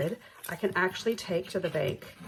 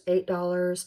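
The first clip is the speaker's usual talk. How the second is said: usual speaking voice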